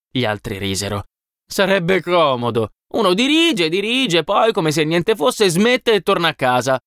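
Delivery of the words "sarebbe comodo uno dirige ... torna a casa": lightly voiced higher-pitched mockery; a hint of amused scorn, pitched up; scoffing worker. A narrator's reading. Worker mocks the easy life of chiefs, lightly scoffing.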